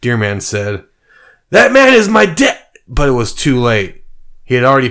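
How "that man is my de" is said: very high, loud, cut off; urgent cry. Acted. Deer Man crying out to the furries, cut off. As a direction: shout it before it's too late.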